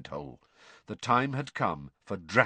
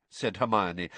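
usual speaking voice

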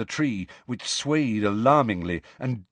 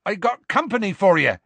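high, raised announcement; high-pitched projected call; shouting; 9 semitones higher, 4 dB louder than usual. A narrator's reading. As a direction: shout the news up to a giant. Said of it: "Hagrid shouted".

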